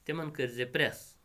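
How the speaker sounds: ordinary delivery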